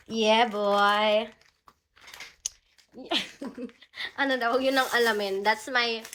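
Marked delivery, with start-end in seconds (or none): none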